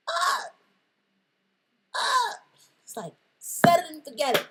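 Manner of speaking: loud, high screaming, then a fast shout; ecstatic scream. Acted. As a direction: scream with delight. Ecstatic screams after excitement.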